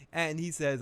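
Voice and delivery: ordinary delivery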